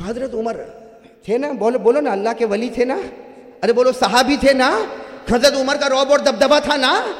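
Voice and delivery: impassioned preacher's climb; fiery sermon. Acted. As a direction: drive it home to the crowd. Preacher rousing the audience.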